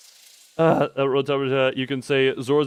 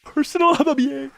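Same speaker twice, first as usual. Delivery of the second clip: mocking high echo; 10 semitones higher than usual. Streamer teasingly parrots a chat phrase, high mocking echo. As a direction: repeat it back much higher, in a silly put-on voice.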